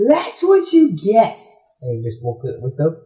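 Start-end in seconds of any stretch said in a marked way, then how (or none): 0.1-1.4 much higher, louder and slow, gloating; spring the trap and gloat; gloating fish. Retold or imitated. Fish snapping at prey, high, loud, slow gloat.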